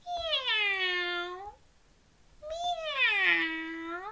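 high, soft meow; meowing cat. Shown as a demonstration. Host meows like a cat for the children, high. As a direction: mew like a little cat. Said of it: "can you meow like a cat?".

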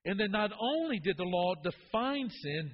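ordinary talk

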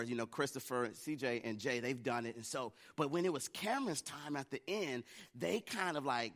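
normal talking voice

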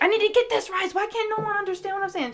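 high, exasperated grandma impression; mad grandma. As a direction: plead crossly at not being understood, speeding up. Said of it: "Like, she got mad".

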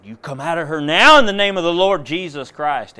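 a high, very loud rebuke in Jesus' name; commanding shout. Retold or imitated. Father rebuking the spirit in his daughter, booming command. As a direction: drive the demon out with full force. Casting out a demon.

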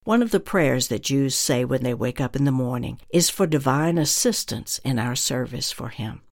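ordinary delivery

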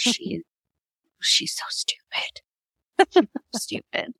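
slow, whispered mock insult; hushed whisper. Real, not acted. Owner confiding about the family dog, whispered. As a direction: drop to an emphatic whisper.

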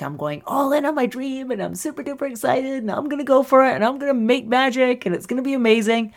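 imitating a breathless, giddy dreamer; all excited. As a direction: gush like someone starting out on a dream. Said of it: "And we go all excited".

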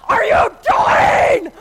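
huge, high bellow with a long pause; hammed-up towering angry mother; mad. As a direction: roar it word by word, drawn out. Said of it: "When I'm mad".

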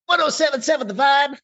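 over-the-top high radio DJ shout; top forty DJ. Shown as a demonstration. Host retries the back-sell, hammed-up high DJ shout. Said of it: "back selling that on a top forty radio station".

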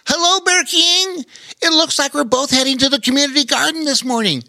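put-on very high, bright young otter; squeaky otter voice. A narrator's reading. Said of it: "said Bobo Chico as he came skipping".

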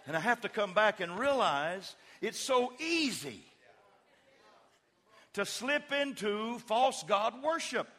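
loud, high, slow preaching climb; full-force preaching. Acted. Pastor crying out to his congregation about false gods. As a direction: drive it home to the congregation.

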